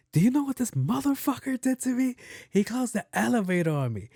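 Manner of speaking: livid, high-pitched. Retold or imitated. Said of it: "She was livid".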